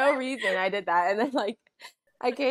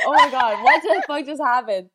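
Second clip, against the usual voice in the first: laughing shock; 4 semitones higher, 9 dB louder than usual. Retold or imitated. Sister recalls her own shock.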